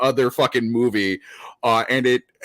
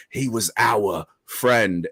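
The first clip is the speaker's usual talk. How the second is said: imitating an actor slipping an Australian "our" into an American line, slow; mock Australian twist on the word "our"; 4 semitones lower, much slower than usual. Quoting a True Blood actor's slip into Australian on "our". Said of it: "he adds the Australian accent to that word".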